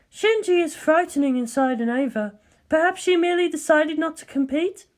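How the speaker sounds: cool, measured colleague; Ritsuko's voice. Acted.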